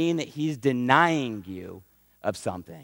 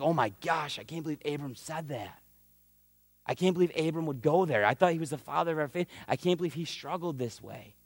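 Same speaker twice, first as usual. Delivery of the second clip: shocked disbelief; 7 dB quieter than usual. Retold or imitated. Hushed disbelief as believers reading about Abram. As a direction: mutter it, as if scandalized by Abram. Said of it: "we're like".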